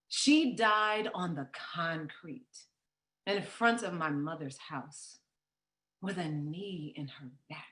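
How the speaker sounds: quiet, heavy sorrow; grieving. Acted. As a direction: let it sink almost to nothing in grief. Sister recounts the death.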